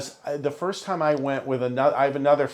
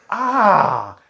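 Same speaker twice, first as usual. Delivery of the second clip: retold realization; 6 semitones higher, 8 dB louder than usual. Acting out his sudden realization.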